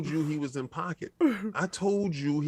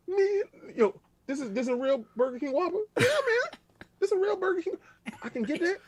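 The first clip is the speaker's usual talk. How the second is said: hyped disbelief. Retold or imitated. Customer amazed at gas-station Whopper.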